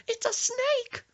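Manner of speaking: loud, piercing high shriek; terrified scream. Retold or imitated. Friend insists the garden snake is scary, screaming. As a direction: shriek it, hammed-up panic.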